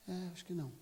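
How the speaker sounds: softly conceding the point; hesitant concession. Acted. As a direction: give in quietly, after a thought. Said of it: "Ele teve que pensar".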